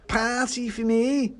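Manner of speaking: high, slow tipsy disbelief; boozy pudding thrilled at the news; drunken pudding. A narrator's reading. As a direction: squeal it slowly, overjoyed and tipsy. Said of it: "has definitely been drinking".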